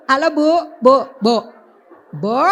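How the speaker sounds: loud, insistent hail across the room; calling for attention. Real, not acted. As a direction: call out repeatedly to get a listener's attention. Calling an inattentive participant to listen.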